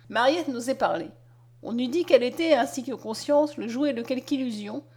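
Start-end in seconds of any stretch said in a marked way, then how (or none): none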